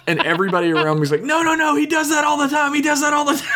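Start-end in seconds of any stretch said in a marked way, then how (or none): 1.2-3.5 imitating onlookers in a very high, rapid squeal; high-pitched mock pleading, tumbling out fast; retold panicked protest. Everybody around hurriedly explaining he does that all the time, mock panicked protest.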